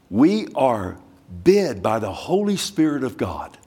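ordinary delivery

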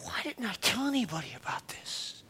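hushed, voiceless regret; regretful whisper. Retold or imitated. Believer in heaven regrets never sharing, whispered. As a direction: whisper it like a confession.

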